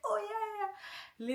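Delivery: sister's eager agreement. Retold or imitated. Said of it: "she's like".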